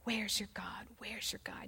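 low, hushed needling taunt; quiet mocking jab under the breath; nagging taunt. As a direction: pester softly, like a voice that won't let up. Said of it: "continually is plaguing and pushing on him".

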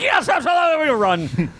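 high, hammed-up excitement; over-eager agreement. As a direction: gush a mock yes, pitch way up. Mimicking an over-eager yes.

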